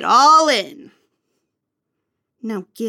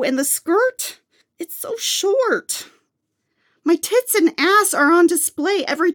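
disgusted complaining; 7 semitones higher than usual. Acted. Squeezed into tight maid outfit.

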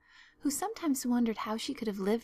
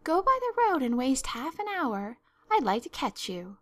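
high, loud, affronted question, then warning; indignant scolding; 5 semitones higher, 5 dB louder than usual. Acted. Marilla forbids Anne the long way round, indignant. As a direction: challenge it sharply, then threaten.

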